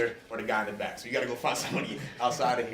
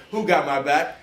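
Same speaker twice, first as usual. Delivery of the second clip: shouted higher to the crowd; loud rallying call; 4 semitones higher, 12 dB louder, slower than usual. Real, not acted. Speaker hyping up students for the game, loud call. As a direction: belt out the call for a response.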